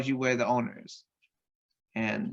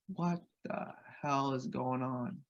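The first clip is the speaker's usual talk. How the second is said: slow, much softer, shaken; hushed disbelief at a gun; retelling his frightened self; scared; 9 dB quieter, slower than usual. Retold or imitated.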